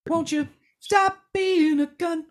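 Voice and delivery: high, slow sing-song jab; high mock voice. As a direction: tease in a high drawn-out voice. Mock sing-song insult bit.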